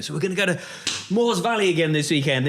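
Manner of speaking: put-on high, eager question from a kid; light imitation of an eager young daughter; excited daughter. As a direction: mimic a keen child hoping for the trip. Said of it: "I always remember her reaction going".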